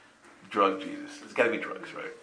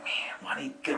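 whisper; 3 semitones higher, 8 dB quieter, much faster than usual. Retold or imitated. Speaker's inner frustration, hushed.

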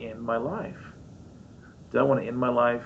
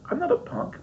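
mock protest. Protesting being called punk.

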